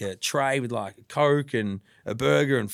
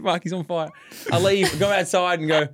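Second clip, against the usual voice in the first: normal talking voice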